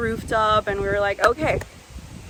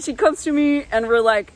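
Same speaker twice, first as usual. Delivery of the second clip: ordinary talk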